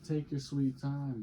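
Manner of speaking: ordinary talk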